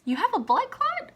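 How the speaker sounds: sharp high rise, said as a disbelieving question. Retold or imitated. Doctor telling the patient her diagnosis, unsure questioning rise. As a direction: ask it like you can't believe it yourself. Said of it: "he said it like it was a question".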